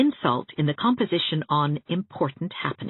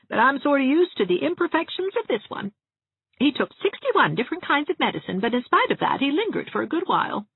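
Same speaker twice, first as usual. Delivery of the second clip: put-on higher, chatty gossiping old dressmaker; higher-voiced prim spinster relishing every funeral; Miss Valentine; 7 semitones higher than usual. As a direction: gossip cheerfully about the dead.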